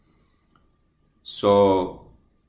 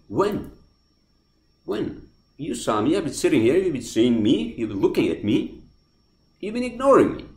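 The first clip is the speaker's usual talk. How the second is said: higher and louder, building outrage; furious accusation; 6 semitones higher, 3 dB louder than usual. Retold or imitated. Accusing the cashier of ignoring him, higher and louder. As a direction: hammer him with each complaint.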